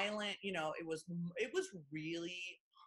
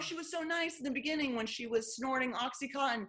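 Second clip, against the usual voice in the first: sarcastic mock reader; 8 semitones higher than usual. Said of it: "You know what I mean?".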